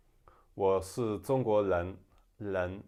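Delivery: southern accent with "人" as "len"; slow Taiwanese-style accent, L for R. Shown as a demonstration. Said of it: "it can become L".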